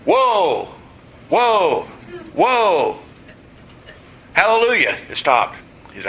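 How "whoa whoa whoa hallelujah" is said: higher pitched calls to rein in a horse; hammed-up pull on the reins; mock whoa. Pastor trying to stop his runaway horse, mock calls.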